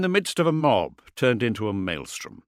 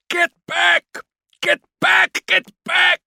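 very high, loud repeated yell; raging shout; 8 dB louder, slower than usual. A narrator's reading. Kord shouting as he lashes out, raging and desperate. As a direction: shout it like raging punctuation to each blow. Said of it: "Kord's shout was meaningless".

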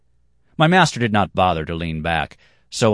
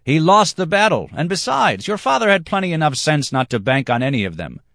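much higher, louder retort; loud declaration; 10 semitones higher, 5 dB louder than usual. Acted. Dancer shouts his retort, high and loud. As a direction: shout it while breaking from the dance. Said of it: "long enough to declare".

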